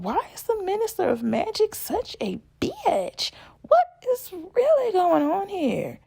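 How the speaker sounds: high, incredulous rant; outraged. Real, not acted. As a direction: rant in disbelief.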